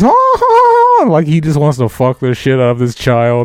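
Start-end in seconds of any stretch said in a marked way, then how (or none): 0.0-1.0 high, loud drawn-out groan of desire; lustful groan. Retold or imitated. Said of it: "and he just goes".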